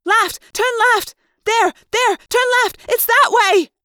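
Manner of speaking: very high, loud urgent shouts; frantic high-pitched yelling; screamed directions. A narrator's reading. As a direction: shriek the directions in a panic. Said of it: "taking time out from screaming".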